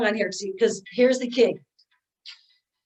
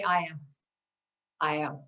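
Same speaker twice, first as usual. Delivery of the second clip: said softly; 7 semitones lower, 7 dB quieter than usual. Shown as a demonstration.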